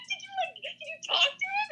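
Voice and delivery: high, breathless fan question; excited question. Retold or imitated. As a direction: fire it off like a starstruck fan.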